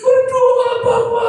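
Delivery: put-on very high, wailing toddler voice; crying small child. Three-year-old begging dad to stop and pray, crying. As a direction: imitate a toddler's loud, drawn-out plea. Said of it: "Anak saya itu sih umur tiga tahun".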